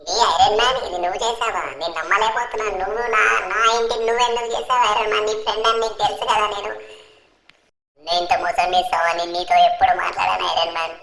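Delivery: aggrieved, whining complaint; betrayed Franklin. Acted. Franklin complains to the Avengers about Iron Man, hurt. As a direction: appeal tearfully against a friend's betrayal.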